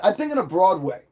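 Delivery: ordinary talk, nothing marked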